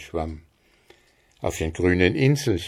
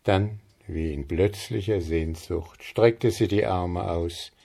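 ordinary delivery

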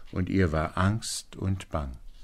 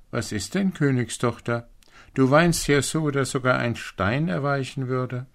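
frog calls out to her, higher voice; calling frog; 6 semitones higher, 4 dB louder than usual. A narrator's reading. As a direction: call over in a lightly voiced, raised frog voice. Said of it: "rief ihr jemand zu". (rufender Frosch, mit höherer Stimme)

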